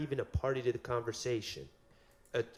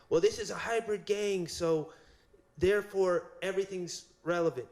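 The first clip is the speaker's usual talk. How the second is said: lightly voiced prosecutor, pitched higher in mockery; mocking the state; 7 semitones higher than usual. Defense lawyer mocks the state's argument to the judge, mildly higher mock voice. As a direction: parody the other side's stock argument, a touch sing-song. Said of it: "the state believes that they have this unbeatable argument".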